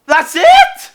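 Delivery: loud, high, stunned cry of disbelief; can't believe it's over, half shouting. Real, not acted. Streamer shouting in disbelief at the empty reveal.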